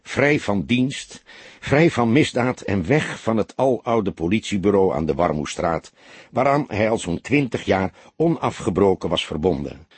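plain reading voice

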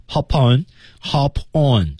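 "Hop on" linked as "ho-pon"; linked reading. Shown as a demonstration. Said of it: "连读". (把“Hop on”连读)

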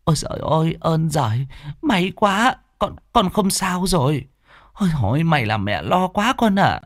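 high, tearful mother's relief; relieved crying mother. Acted. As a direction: sob it out, so glad he is safe. (người mẹ mếu máo, mừng rỡ, giọng cao)

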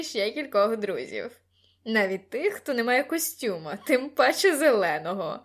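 put-on loud, brash teenage boy; loud, self-satisfied lad chatting away; Gordon, cocky classmate. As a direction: boast over the party noise.

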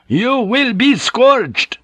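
very slow, high, loud pronouncement; furious decree. A narrator's reading. Superior priest overruling a brother's refusal, furiously. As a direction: hammer each word with implacable anger.

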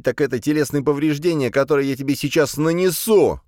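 high-pitched aggressive tirade; worked-up, menacing self-defence; threatening. A narrator's reading. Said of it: "С этими словами я угрожающе поднялся".

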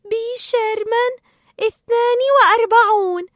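very high, elated recital; excited reciting. Acted. Dory delights in her memory, excited reciting. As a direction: repeat it proudly in a high, thrilled voice. Dory thrilled, repeats the address.

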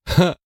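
sudden high manic bark of laughter; crazed laugh. Acted. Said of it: "Он вдруг безумно рассмеялся".

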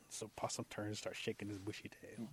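ordinary delivery

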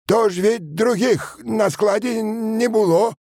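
high, drawn-out peasant voice; a peasant making excuses, pitched high and slow; defensive peasant. A narrator's reading. (мужик оправдывается высоким голосом)

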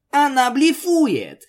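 loud high retort; high, loud, slow exclamation. Acted. Heated argument, sharp retort. (громкий высокий возглас)

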